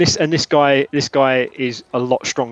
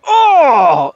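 pained cry; 13 semitones higher, 9 dB louder than usual. Acted. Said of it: "and he's like".

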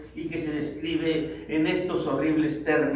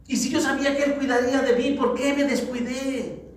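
high-pitched self-blame, slowing on the final question; self-tormenting reproach; 6 semitones higher, 4 dB louder than usual. Acted. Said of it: "atormentándote tú mismo". (autorreproche atormentado, en tono agudo)

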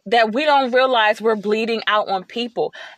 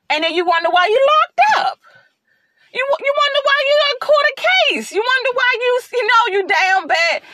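heated warning; 12 semitones higher than usual. Real, not acted. Warning viewers about bottled rage.

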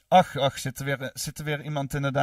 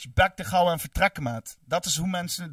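ordinary delivery

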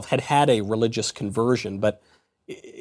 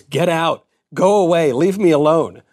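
half-shouted, louder and higher; retold scream; 4 semitones higher, 5 dB louder than usual. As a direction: read his outburst as a mild mock yell. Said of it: "he screams".

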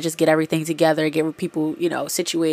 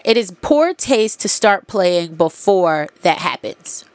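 usual speaking voice

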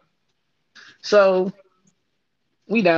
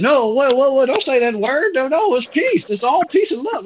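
high, panicky voice of a flustered supervisor; 9 semitones higher than usual. Retold or imitated. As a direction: squeak it out like a panicked boss backpedalling. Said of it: "He like".

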